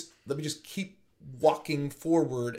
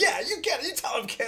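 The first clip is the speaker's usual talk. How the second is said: high, put-on cheering voice; mock cheerleader; 11 semitones higher, faster than usual. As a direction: egg the kids on in a silly voice.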